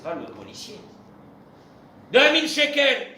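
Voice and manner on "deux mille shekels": loud mock outrage at a fine; exclaimed fine. Rabbi mocking a driver's fine, loud indignant exclaim. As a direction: announce the fine loudly, indignant.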